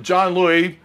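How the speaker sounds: ordinary delivery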